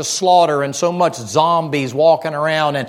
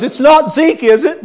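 neighbor's worried guess; 9 semitones higher, 5 dB louder than usual. Retold or imitated. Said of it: "he goes".

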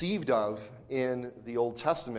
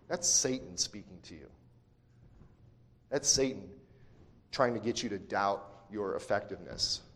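ordinary talk

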